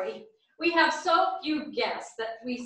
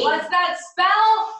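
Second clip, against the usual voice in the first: cheer call; 5 semitones higher, 7 dB louder than usual. Acted. Cheerleader asks for the word.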